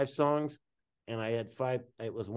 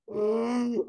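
breathing in and out; 9 semitones higher than usual. Retold or imitated. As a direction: suck air in as if through a harmonica. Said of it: "I breathed in, I breathed out".

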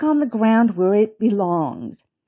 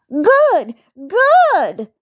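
put-on high, loud little-girl cheer; delighted cry; 9 semitones higher, 5 dB louder than usual. A narrator's reading. Rabbit girl cheering uncle's jump, high loud cry. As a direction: cheer it twice, the second higher. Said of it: "cried Baby Bunty".

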